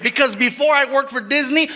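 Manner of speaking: ordinary delivery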